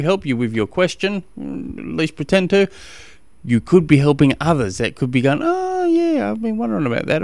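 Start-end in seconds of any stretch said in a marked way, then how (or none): 5.4-7.2 high, soft mock listener voice; a light imitation of a grateful fan; curious listener. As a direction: pop up high like someone who just got it.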